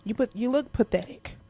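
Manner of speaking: ordinary talk, nothing marked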